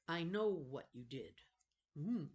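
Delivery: usual speaking voice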